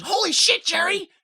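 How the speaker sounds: mock yell tinged with disappointment; Goldilocks. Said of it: "follows it up with her disappointment".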